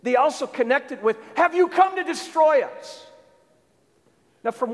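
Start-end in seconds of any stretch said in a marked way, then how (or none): 1.4-3.1 high, loud demonic challenge; shouting out. Acted. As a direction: hurl the question out as a shouted accusation. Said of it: "the demonic shouting out".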